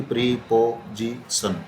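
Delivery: Preposition slowly in four beats, प्री-पो-जी-सन; syllable by syllable. Shown as a demonstration. Teacher proving it breaks four times, preposition in separated syllables. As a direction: count out preposition with a break after each syllable. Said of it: "चार बार टूटी".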